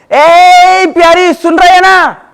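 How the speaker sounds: loud, high call to a student. Real, not acted. Teacher calls out to a student. (ज़ोर से, ऊँची आवाज़ में पुकारते हुए)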